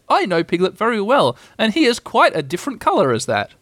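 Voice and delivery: put-on lilting, confident boy; Christopher Robin, assured. A narrator's reading.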